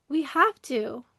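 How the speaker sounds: normal talking voice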